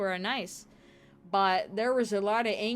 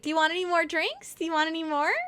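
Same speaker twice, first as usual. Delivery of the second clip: higher, sing-song fake-friendly offer; mock sweet hostess; 5 semitones higher, faster than usual. Coworker sweetly offering drinks, mock sugary voice. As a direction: imitate her sugary fake hospitality. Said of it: "she'd be like".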